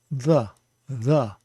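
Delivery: "the" com th suave, como em inglês; soft th. Shown as a demonstration. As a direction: diga "the" com menos ênfase. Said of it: "a little less emphasis".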